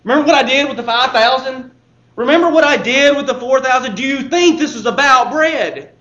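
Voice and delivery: loud, exasperated teacher scolding his students; Jesus chiding the disciples, exasperated and loud. Acted. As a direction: scold them for missing the point again. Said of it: "Jesus just shakes his head".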